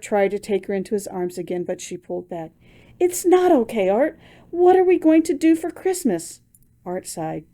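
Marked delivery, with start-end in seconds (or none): 3.0-6.4 high, louder, near tears; upset protest. Acted. Wife refusing comfort, upset tearful protest. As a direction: push back through tears.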